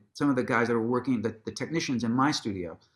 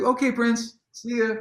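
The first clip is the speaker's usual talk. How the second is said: starstruck okay; 9 semitones higher than usual. Retold or imitated. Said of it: "I was like".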